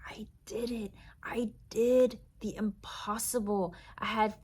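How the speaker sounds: whispered in awe at your own win; triumphant whisper. Pretend. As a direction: whisper it like a champ who can't believe it. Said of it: "You're gonna be like".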